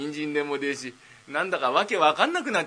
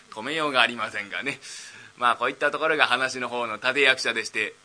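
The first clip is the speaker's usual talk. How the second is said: ordinary delivery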